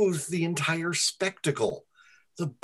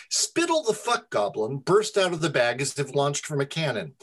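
plain reading voice

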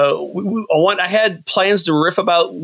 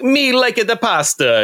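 put-on hammy Italian accent, pitched up; mock Italian accent; 8 semitones higher than usual. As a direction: ham up a fake Italian accent. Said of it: "He's like".

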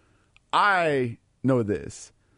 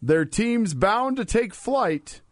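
poem reading; 5 semitones higher, slower than usual. Acted. Reads limerick, continued.

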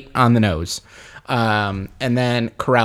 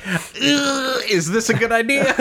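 nervous doubt; 10 semitones higher, slower than usual. Retold or imitated. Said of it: "And I was like".

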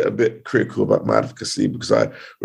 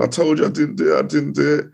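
higher, pleading echo of the crying man; retold crying plea; 7 semitones higher, faster than usual. Defendant freed after two years, a higher mock-tearful plea. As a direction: relay his tearful relief, pitched up. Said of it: "he was crying, saying".

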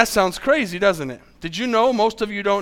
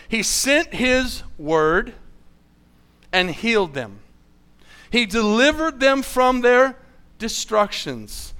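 scripture reading; 3 semitones higher, slower than usual. Acted. Reads scripture slowly.

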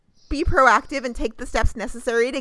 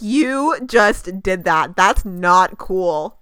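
mildly mad; 3 semitones lower, 4 dB louder, much slower than usual. Retold or imitated. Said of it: "I was a little bit mad at him".

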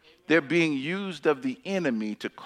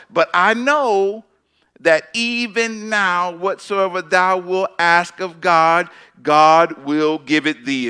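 forceful proclamation; 2 semitones higher, 7 dB louder, slower than usual. Acted. Preacher reads Martha's confident faith.

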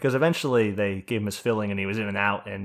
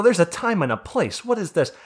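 retold indignant outburst; 7 semitones higher, 3 dB louder than usual. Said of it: "I go".

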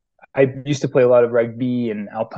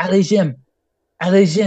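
high, mock French cheer; cheering like a French fan, pitch up; French accent; 7 semitones higher, faster than usual. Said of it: "in this French accent".

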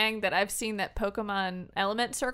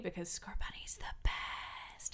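whispered aside, slower; 3 semitones lower, 12 dB quieter, slower than usual. Real, not acted. Whispering to friends about her starter pick. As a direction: breathe it out like a secret.

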